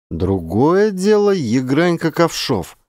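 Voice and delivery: ordinary delivery, nothing marked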